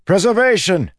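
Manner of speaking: high desperate call; a frantic shout for help; shouting the name. Acted. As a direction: cry out to the empty sky. Calling out for the god.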